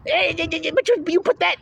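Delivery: high, sputtering mockery of a flustered critic; a critic caught out, stammering in a high mock voice. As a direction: sputter it out like you're caught off guard. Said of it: "See how I'm saying it like that?".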